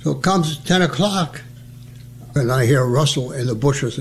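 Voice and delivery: usual speaking voice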